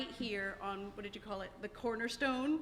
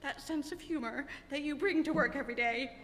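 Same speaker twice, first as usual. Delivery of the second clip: higher, louder, voice full of tears; choked up with tears; 6 semitones higher, 8 dB louder than usual. Real, not acted. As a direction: push the thanks out through real tears. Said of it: "I don't know why I'm so emotional".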